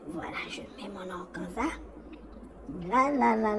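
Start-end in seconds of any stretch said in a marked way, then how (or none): 0.1-1.7 whispered aside; barely voiced murmur to herself; chuchoté. Real, not acted. Murmurs to herself while working.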